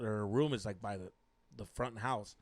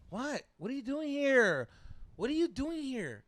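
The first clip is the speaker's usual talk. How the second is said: mock surprised girlfriend; 9 semitones higher than usual. Girlfriend to boyfriend at her door, mock surprised. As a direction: imitate her high-pitched startled questions. Acting out girlfriend caught off guard.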